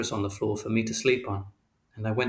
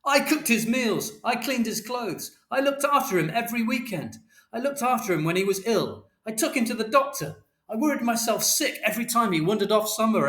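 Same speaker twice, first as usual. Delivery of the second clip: angry tirade; 11 semitones higher than usual. A narrator's reading. Father telling Mother all he did, shouting. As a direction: hammer each grievance home in a high voice. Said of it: "And Father shouted".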